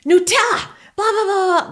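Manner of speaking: high, loud yell at the dog; retelling a scream at the dog, high and loud; mock scream. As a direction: scream the dog's name in a burst of anger. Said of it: "And I screamed".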